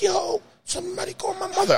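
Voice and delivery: high strained cry for his mother; mock desperate call for mother; desperate yell. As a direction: yell for help from the pavement. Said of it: "And I'm like".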